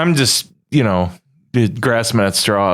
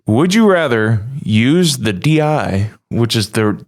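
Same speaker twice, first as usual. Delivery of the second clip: ordinary delivery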